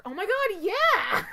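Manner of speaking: high, loud excited exclamation; excited yelp. Retold or imitated. Brother agreeing with the mom, loud and thrilled. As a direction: gush in loud, high delight. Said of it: "he was like".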